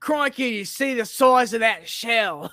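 put-on Crikey, wildlife-host Australian accent; hammed-up croc hunter. Restarting the croc hunter improv about a shell, Australian accent. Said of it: "It's an Australian accent?".

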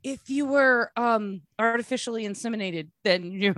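usual speaking voice

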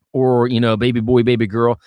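normal talking voice

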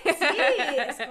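a laughing yes; laughing along. Real, not acted. Laughing at the shared joke.

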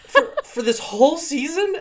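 rising incredulous squeal; high, loud disbelieving question; shocked disbelief. Retold or imitated. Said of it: "And I was like".

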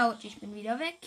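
ordinary talk

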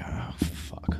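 breathy whispered oh fuck; whispered curse. Retold or imitated. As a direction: hush it like a guard caught off guard. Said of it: "they were like".

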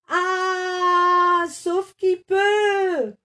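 high, loud, slow sing-song panic; terrified screaming. A narrator's reading. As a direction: shriek and flee. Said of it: "hurlèrent les animaux terrorisés".

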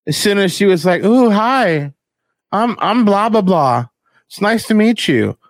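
high, bubbly mock pickup voice. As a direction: play the sweet-talking gold digger. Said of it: "comes up to me like".

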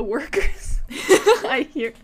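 stumbling through giggles; breaking up mid-line; laughing. Real, not acted. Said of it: "stop laughing at me".

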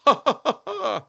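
very high mock laugh, breaking on the last ha; imitated little laugh. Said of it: "My voice keeps cracking in the fourth one".

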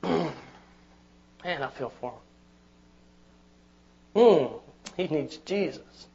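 a tender sigh of compassion; soft compassionate murmur. Pretend.

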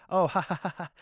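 mock laugh in separate, loud-then-fading ha-has; sarcastic fake laugh. Joking with mom, sarcastic fake laugh. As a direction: laugh it out as an obvious put-on. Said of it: "I know, it was sarcasm".

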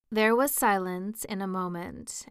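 ordinary delivery, nothing marked

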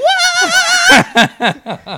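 loud, high cackle of laughter; high squealing laugh. Real, not acted. Laughing at own story.

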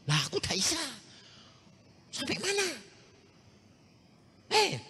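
usual speaking voice

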